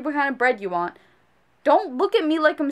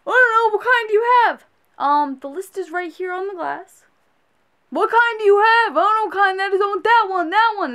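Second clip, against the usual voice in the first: high, louder whiny customer; clueless customer voice; 5 semitones higher, 4 dB louder than usual. Retold or imitated. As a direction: mimic a confused customer pointing. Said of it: "when you ask, "Oh, oh, okay, this is me".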